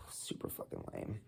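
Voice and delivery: very quiet, nearly voiceless verdict; real low-energy disappointed murmur; deflated murmur. Real, not acted. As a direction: say it under your breath, let down. Deflated verdict after sighing.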